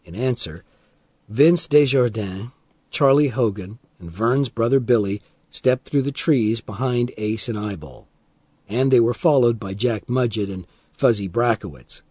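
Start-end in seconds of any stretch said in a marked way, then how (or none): none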